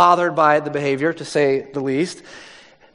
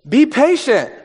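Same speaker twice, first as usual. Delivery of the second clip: begging. Acted. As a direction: beg the master for more time. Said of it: "he begged".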